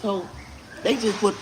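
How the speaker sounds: usual speaking voice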